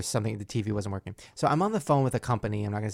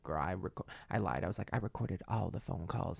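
lower, softer menacing warning; low threat; 3 semitones lower, 6 dB quieter than usual. Retold or imitated. Host reenacting threatening the company, low and soft.

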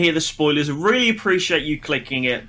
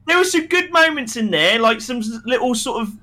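worked-up rant; 7 semitones higher than usual. Real, not acted. Continued rant.

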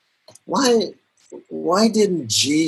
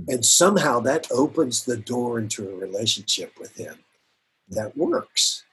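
ordinary talk

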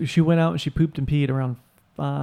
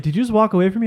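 higher, indignant imitation of mom; mock offended mother; 7 semitones higher, faster than usual. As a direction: act out a hurt mom, pitched up. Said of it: "she's like".

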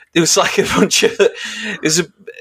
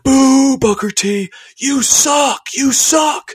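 boo him like a hammed-up American fan; mock crowd booing; 12 semitones higher, much slower than usual. Jeering at Booker T on the ramp, high mock booing.

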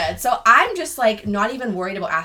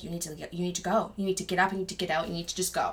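inner gut voice; 2 semitones lower, 7 dB quieter, faster than usual. Retold or imitated. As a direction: act out an urgent inner voice under your normal volume. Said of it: "Mariah inside of me is like".

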